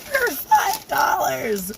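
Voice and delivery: drawn-out delight. Real, not acted. Delighted at the cheap price.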